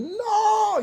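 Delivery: shrill, very high shrieked no; a spirit shrieking as it is cast out; piercing high cry of refusal; demon's scream. Retold or imitated. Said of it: "hay personas que salen y dicen".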